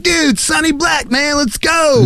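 high, slow dude-bro hype; frat bro voice. Retold or imitated. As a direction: call him out like a college bro. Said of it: "They were like".

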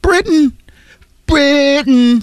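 very high, drawn-out British squeak; mock British accent. Capping the joke about the driver, mock British. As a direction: squeal it like a comic Brit.

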